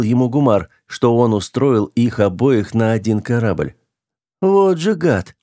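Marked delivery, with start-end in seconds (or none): 4.4-5.4 high-pitched grudging admiration; admiring exclamation. A narrator's reading. Officer thinks of who set this up, admiring. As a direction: exclaim it higher, impressed. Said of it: "восхищённо подумал Сюр".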